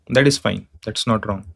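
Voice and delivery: usual speaking voice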